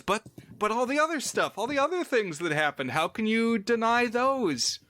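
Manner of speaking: high, fast, pleading objection; indignant protest. Retold or imitated. Researcher defending the haunting to doubters, high indignant protest.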